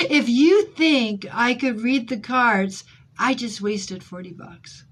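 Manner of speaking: loud, high, slow laughing retell; giggling punchline; laughing through words. Real, not acted. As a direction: laugh while you say it.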